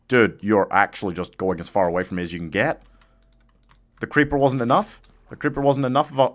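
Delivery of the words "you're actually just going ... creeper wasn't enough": ordinary delivery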